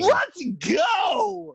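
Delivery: high, loud victory shout; excited shout. Real, not acted. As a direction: yell it out in triumph. Celebrates a correct guess.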